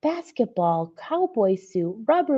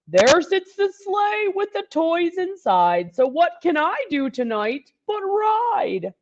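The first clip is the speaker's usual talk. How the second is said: high, louder jolly Santa voice; a keen old Santa ready to ride; eager Santa; 7 semitones higher, 6 dB louder than usual. Acted. Santa speaks in held voice.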